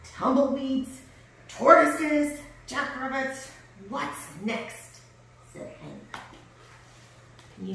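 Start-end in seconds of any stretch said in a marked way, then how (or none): 0.0-5.0 high, exasperated list, loud at first. A narrator's reading. Hank complains about his visitors, exasperated. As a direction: tick off each nuisance grumpily. Grumpy cactus lists unwelcome visitors.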